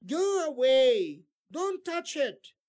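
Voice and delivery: loud, slow order, rising in pitch; teacher barking a warning at a child; stern command. Shown as a demonstration. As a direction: order them off firmly. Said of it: "an order or a command".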